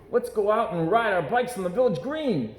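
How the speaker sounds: a hint of eager boy. Brother suggests bike riding.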